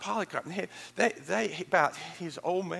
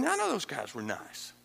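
ordinary delivery

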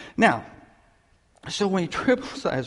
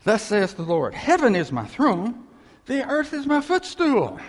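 booming scripture reading, building higher on footstool; solemn scripture reading; 7 semitones higher, 6 dB louder than usual. Real, not acted. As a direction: proclaim it like the Lord speaking from the throne. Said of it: "Isaiah fifty-seven".

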